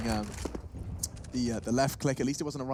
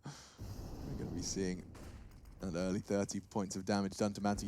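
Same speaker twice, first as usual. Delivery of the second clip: hoarse voice; 5 semitones lower, 10 dB quieter than usual. Real, not acted. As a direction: croak through a tired throat. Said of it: "my voice is going too".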